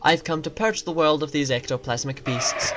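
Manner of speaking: ordinary talk, nothing marked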